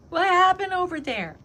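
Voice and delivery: a nosy bystander leaning in; curious onlooker. Retold or imitated. Another gym lady asking about the fuss, high loud nosy voice.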